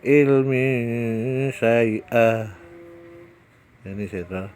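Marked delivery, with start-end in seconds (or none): 0.1-2.5 "Ilmi syai'ah" recited louder, tanwin hummed into the sh; Quran recitation. Shown as a demonstration. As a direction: chant "Ilmi syai'ah" in tajwid style. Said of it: "Cara membacanya seperti itu". (lantunkan "Ilmi syai'ah" lebih keras)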